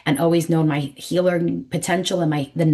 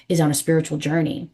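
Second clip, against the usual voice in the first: ordinary talk, nothing marked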